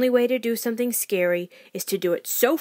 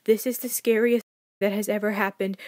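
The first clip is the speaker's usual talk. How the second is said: plain reading voice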